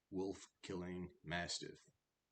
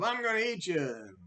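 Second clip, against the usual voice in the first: high mock troll threat; menacing troll; 12 semitones higher, 11 dB louder than usual. Troll jumping up at the biggest goat, hammed-up threat.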